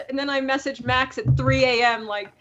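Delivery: normal talking voice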